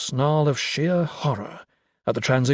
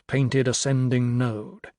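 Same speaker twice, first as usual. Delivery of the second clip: usual speaking voice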